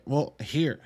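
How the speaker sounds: ordinary talk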